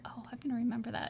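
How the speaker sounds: quick whispered aside; whispering. Retold or imitated. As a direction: whisper it fast to yourself. Retold thought, whispered.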